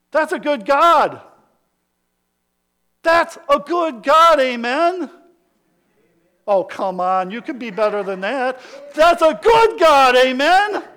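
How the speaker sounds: loud, high, rising sermon climax; a thundering preacher's build; preaching at full force. Acted. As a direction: preach it out to the back row. Preacher calls for response.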